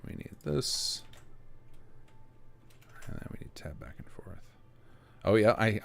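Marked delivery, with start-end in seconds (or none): 0.1-4.4 soft breathy ASMR whisper. Real, not acted. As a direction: drift into a hushed ASMR voice. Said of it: "I'm getting into A S M R voice by accident".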